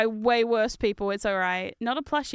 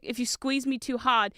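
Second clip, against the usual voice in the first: usual speaking voice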